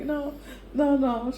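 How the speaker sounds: mock-flustered no, pitched well up; high protesting no. Tourist refusing the restaurant lady, high and flustered. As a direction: brush it off in a high squeaky voice. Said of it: "I was like".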